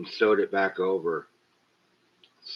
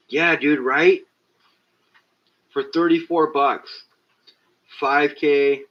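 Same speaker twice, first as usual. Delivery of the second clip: higher and louder, excited bragging; excited brag; 5 semitones higher, 5 dB louder than usual. Real, not acted. Streamer bragging to chat about his cheap five K camera, excited. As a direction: hype up the bargain to your viewers.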